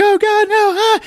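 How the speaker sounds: very high, loud hammed-up cry; mock pained shriek. Woman burned by shower water, hammed-up shriek. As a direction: squeal as if scalded. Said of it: "she's like".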